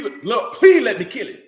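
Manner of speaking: loud, high begging plea; pleading to kill. Retold or imitated. Servant begging King David, loud and high. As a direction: beg the king to let you strike.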